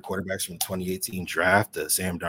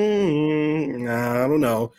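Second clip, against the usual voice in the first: long, higher hesitant hum; doubtful; 6 semitones higher, 4 dB louder, much slower than usual. Retold or imitated. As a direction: draw out your doubt before answering. Acting out his doubtful reaction.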